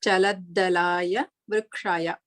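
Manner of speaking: Chaladdalaya vrukshaya said slowly, every syllable clear; careful pronunciation. Shown as a demonstration. Teacher models the first quarter for students, slow and careful. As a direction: spell out Chaladdalaya vrukshaya sound by sound. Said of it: "see the pronunciation of the each and every letter".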